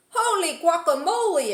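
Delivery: high, loud surprised cry; exclaiming. Acted. Kitten startled by the mirror, high loud exclaim. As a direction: gasp it out at the mirror. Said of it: "exclaimed Skippy John Jones".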